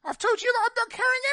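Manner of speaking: indignant protest. Real, not acted. Protesting being roped into moving.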